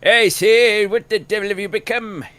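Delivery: high, loud posh British officer voice; old British officer. Retold or imitated. Quoting Peppy's line as an old general. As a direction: play it like an old war pilot. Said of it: "Sound like an old freaking World War One pilot".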